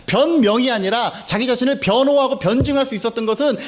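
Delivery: ordinary talk, nothing marked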